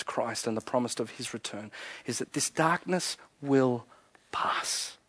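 soft, low words of reassurance; hushed preaching. Real, not acted. Preacher promising the congregation that darkness will pass, hushed. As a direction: speak quietly, fading almost to a whisper at the end.

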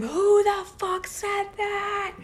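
high shrieking mock yell, slow; mock scream. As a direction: shriek it like a banshee down the hall. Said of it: "I heard her scream".

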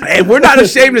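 loud, high, laughing brag; laughing excited boast. Real, not acted. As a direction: crow it out, cracking up. Laughing hyped boast about group.

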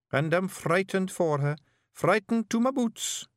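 high-pitched Scots laddie, anxious; high Scottish character voice, intent; earnest young Feegle bard. Acted. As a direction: play a frightened novice sharing his vision. Billy explains his fears.

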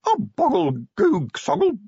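high, gurgling little voice; tiny watery voice. A narrator's reading. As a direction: burble it like something tiny and wet. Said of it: "a very little watery sound".

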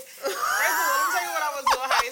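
loud, high, fast shout to grab the floor; shouting over everyone. Real, not acted. Talking over his laughing cohost, a loud high shout. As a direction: yell it out fast to take the mic.

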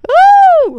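loud excited whoop; loud cheer. Real, not acted. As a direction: cheer right into the mic. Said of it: "Oh, sorry. Forgot I was close to the mic".

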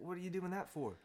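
high, loud Aussie lizard questioning; Australian accent. Acted. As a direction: challenge it in a hammed-up Aussie accent. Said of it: "not even gonna bother doing the accent".

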